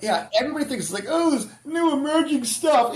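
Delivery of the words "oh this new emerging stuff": mock wonder. Mocking what everybody thinks.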